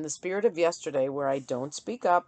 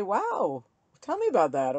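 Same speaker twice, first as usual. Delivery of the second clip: mock interested reply; 4 semitones higher, 4 dB louder than usual. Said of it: "I could just say".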